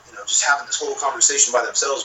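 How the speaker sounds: ordinary delivery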